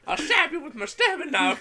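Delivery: goofy villain voice. Pretend. Goofy menacing voice.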